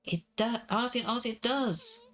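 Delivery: put-on lightly high, pleading child; a small girl begging to be believed; little girl voice.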